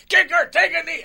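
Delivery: high, loud barked order across the field; retold coach scream. Coach yelling at the kicker on the sideline, screamed order. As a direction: yell it like a big scary coach. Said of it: "And he's screaming".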